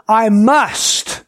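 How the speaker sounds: "must" drawn out as a necessity; stress on MUST. Shown as a demonstration. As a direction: land hard on "must" and stop. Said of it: "emphasizing certain words".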